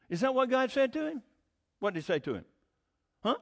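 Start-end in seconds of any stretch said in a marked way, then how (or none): none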